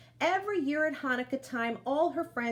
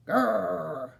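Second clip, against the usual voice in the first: louder low growl from the bear; 4 semitones lower, 4 dB louder than usual. A narrator's reading. Old Bear growls at the smells, a low bear growl.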